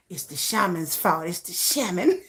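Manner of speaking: low, soft mimicking voice; mock blaming voice. Mimicking others blaming shaman.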